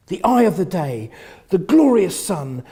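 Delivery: a hint of a florid reviewer, swelling; journalist's gushing review. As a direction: read out the rave review with awe. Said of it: "Suddenly, Mata Hari appears".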